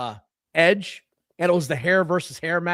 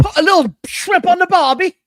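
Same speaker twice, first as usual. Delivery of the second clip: hammed-up Australian accent, high and loud; mock Australian; 11 semitones higher, 7 dB louder than usual. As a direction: play a cartoon Aussie, high and loud. Mock Australian after mate talk.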